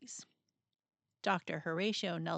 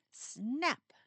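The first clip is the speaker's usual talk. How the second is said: snapping spring; 4 semitones higher than usual. Acted. Spring breaking.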